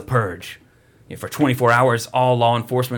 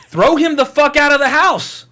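high, loud frustrated shout; exasperated yell; 13 semitones higher, 7 dB louder than usual. Real, not acted. Viewer yells at the family on screen, high loud exasperation. Yelling at the movie.

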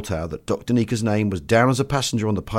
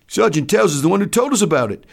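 high, loud soldier's voice; enlisted man retorting; 8 semitones higher, 5 dB louder than usual. Acted. Enlisted man answering the doctor's threat, high and loud. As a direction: answer back loudly, pitched high.

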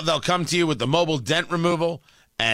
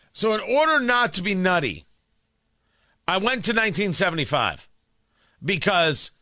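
ordinary delivery

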